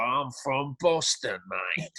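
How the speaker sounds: slow, badly faked Boston accent; mock a terrible Boston accent, drawn out; hammed-up Boston impression by a Brit; crazy Boston accent. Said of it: "he does this crazy Boston accent".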